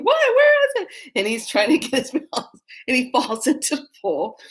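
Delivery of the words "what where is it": loud, very high squeal; mock panic. Husband looking around by pool, mock panic. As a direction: squeal the search in fake fright.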